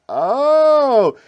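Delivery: high, loud sudden oh of realization; mock surprise. Adjuster spotting the problem, high loud surprised oh.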